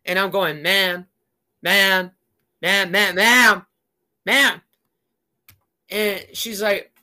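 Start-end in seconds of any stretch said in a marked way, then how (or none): 0.6-4.6 calling out. Retold or imitated. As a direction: call her out more insistently each time. Calling out to the pushy lady.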